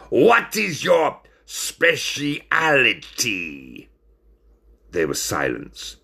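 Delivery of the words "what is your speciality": very slow, pitched-up, laboured repeat; grimacing. Acted. Ringmaster repeating himself to the puzzled friends, slow and pained. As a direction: spell it out slowly, pained by their ignorance. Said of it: "replied with a grimace".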